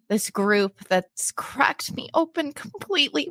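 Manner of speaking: usual speaking voice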